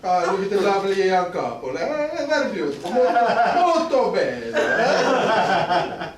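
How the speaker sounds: put-on Italian gondolier accent.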